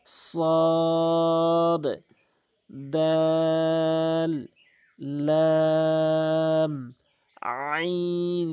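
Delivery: each letter held long and slow, recitation style; drawn-out letter names. Shown as a demonstration. Teacher chanting letter names for students. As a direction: stretch every letter out evenly. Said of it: "তিন আলিফ করে টেনে পড়বেন".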